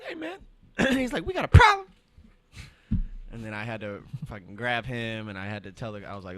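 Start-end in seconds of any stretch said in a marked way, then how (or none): 0.0-1.9 much higher, louder mock-menacing tech voice; tough-guy challenge. Tech threatening the cursing customer, hammed-up menace.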